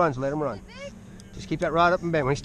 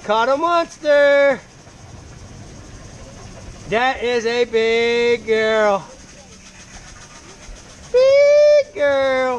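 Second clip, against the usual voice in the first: higher, louder, slow proud cheering; excited celebration; 7 semitones higher, 5 dB louder, much slower than usual. Real, not acted. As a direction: drag it out like bragging. Celebrating the big catch.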